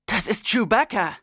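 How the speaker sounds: excited character voice; excited exclamation. A narrator's reading. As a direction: exclaim it in amazement. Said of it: "rief Kellen".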